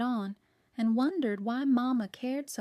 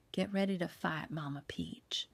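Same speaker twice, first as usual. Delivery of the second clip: soft, hushed and falling away; whispered; 4 semitones lower, 7 dB quieter than usual. A narrator's reading. Michelle holding Mama before the fight, whispered. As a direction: drop to a near-whisper by the end. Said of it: "she whispered".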